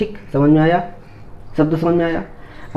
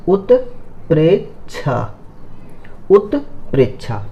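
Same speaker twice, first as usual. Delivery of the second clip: slow careful word; slower than usual. Shown as a demonstration. Teacher explaining a word, very slow. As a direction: stretch उत्प्रेक्षा slowly, each syllable apart. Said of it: "इसको समझते हैं".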